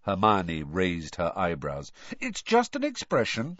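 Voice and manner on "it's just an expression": high, hasty backpedal. A narrator's reading. Ron excusing his remark to Hermione, hastily. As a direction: excuse yourself quickly. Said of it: "said Ron hastily".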